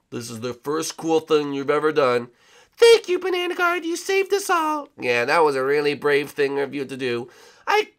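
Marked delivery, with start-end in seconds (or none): 2.8-4.9 higher thankful voice; grateful high voice. Acted. Princess thanking Banana Guard, higher grateful. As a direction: thank him sweetly.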